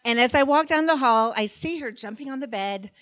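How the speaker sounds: usual speaking voice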